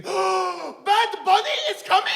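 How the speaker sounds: gasp, then very high drawn-out shriek; excited fan squeal. Acted. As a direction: squeal like a starstruck fan, slowly stretched.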